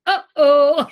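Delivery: high, loud startled uh-oh; a playful gasp of being caught out; mock alarmed uh-oh. Caught by the grandma, a high loud startled uh-oh.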